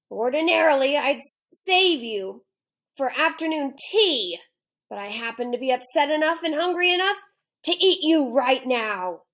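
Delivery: a cranky lion who means to eat him; hungry lion voice. Acted. Lion telling the boy he'll eat him now, loud hungry growl.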